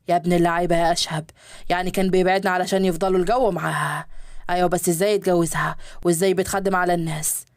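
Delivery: men's voices in a read story; low male guests. Acted. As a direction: play the businessmen, voice dropped low.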